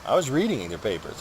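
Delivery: ordinary talk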